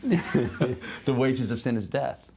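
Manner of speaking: amused, drawn-out scripture line through laughter; laughing quotation. Real, not acted. Guest quoting scripture to the host after a joke, laughing.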